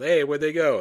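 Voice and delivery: mock host puzzlement. Innkeeper noticing the tourists left, loud mock Italian. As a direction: call out like the baffled innkeeper. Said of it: "he turns around".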